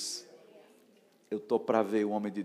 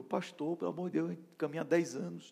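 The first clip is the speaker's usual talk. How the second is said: higher-pitched retold plea; pleading; 6 semitones higher, 3 dB quieter than usual. Disciple begging his pastor at the gate, mock pleading. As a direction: act out begging for another chance.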